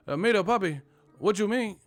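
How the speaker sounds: hammed-up Puerto Rican accent on an angry fan; mock Puerto Rican accent. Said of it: "Puerto Ricans was hot at me".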